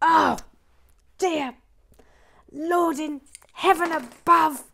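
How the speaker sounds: comic despair to the heavens; theatrical lament; hammed-up dismay. Real, not acted. Despairs at mistake.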